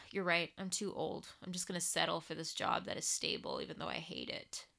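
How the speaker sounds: soft, resigned giving in; appeasing. Acted. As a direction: murmur it like giving up. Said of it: "An appeasing voice comes in and says".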